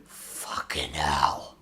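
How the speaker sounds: low, slow, hushed swearing; low mutter. Real, not acted. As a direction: mutter it slowly under your breath. First take before the accented repeats.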